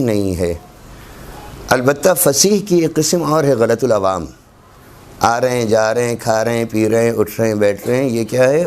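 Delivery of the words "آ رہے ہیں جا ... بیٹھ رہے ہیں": "رہے ہیں" run together, the h dropped, like "ra-e"; colloquial contracted pronunciation. Shown as a demonstration. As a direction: slur "رہے ہیں" into a casual "ra-en". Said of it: "Urdu کی غلط العوام ہے".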